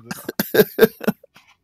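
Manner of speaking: loud high giggle; can't stop laughing; high laughter. Real, not acted. Laughing despite the hernia, loud high giggle.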